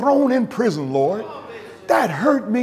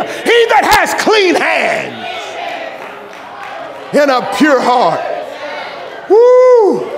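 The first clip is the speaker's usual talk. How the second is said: loud, high whooping preacher in full flight; fiery revival sermon climax; preaching at full force; 5 semitones higher, 4 dB louder than usual. Acted. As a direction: shout it to the whole congregation.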